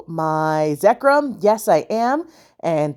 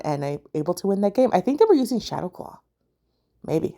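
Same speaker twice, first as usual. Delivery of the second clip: ordinary talk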